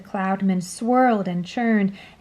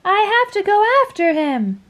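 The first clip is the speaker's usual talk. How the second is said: high, louder urgent call; urgent shout; 11 semitones higher, 5 dB louder than usual. A narrator's reading. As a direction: insist on going. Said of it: "shouted James".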